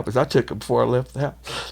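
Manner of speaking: usual speaking voice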